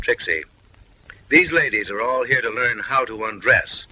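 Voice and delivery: ordinary talk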